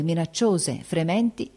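ordinary delivery, nothing marked

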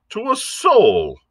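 high-pitched, drawn-out protest; protesting cry. A narrator's reading. Squire denying he told anyone, high indignant cry. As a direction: cry it out high and slow in denial. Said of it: "cried the squire".

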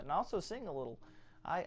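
ordinary talk, nothing marked

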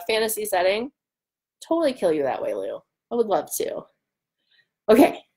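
ordinary talk, nothing marked

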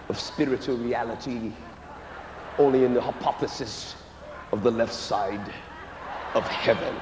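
low, lofty mock lecture; pompous mock explanation; lightly voiced stuffy scholar; mock highbrow theologian. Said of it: "Don't play with me".

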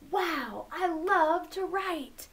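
put-on high, drawn-out gushing for children; exaggerated delighted exclamation; acted excitement. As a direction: stretch it out with big mock excitement. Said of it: "He is excited".

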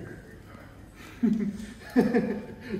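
loud open laugh; hearty laugh. Real, not acted. Laughing with the tourist, a loud real laugh. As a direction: burst out laughing at the joke.

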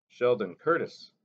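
usual speaking voice

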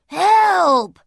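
loud, high cry for help; frightened yell. A narrator's reading. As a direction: yell it in panic. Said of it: "yelled the burglar".

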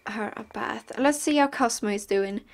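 normal talking voice

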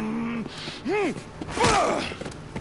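soft real chuckling; quiet burst of amusement; amused laugh. Real, not acted. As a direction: laugh in disbelief at the fight. Player reacts to game fight.

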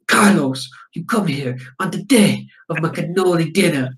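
mock Italian mob-boss accent. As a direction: put on a hammy Italian accent. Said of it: "you can do your Italian accent".

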